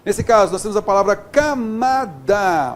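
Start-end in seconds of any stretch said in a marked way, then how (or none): 1.2-2.7 "camada" slowly split into ca, ma, da; each letter sounded. Shown as a demonstration. Teacher showing each letter's sound, "camada" syllable by syllable. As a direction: separate every syllable of "camada". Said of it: "cada letra com seu som".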